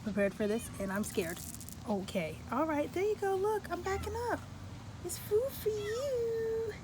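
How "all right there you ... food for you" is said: coaxing pet voice. Real, not acted. Coaxing a stray cat with food.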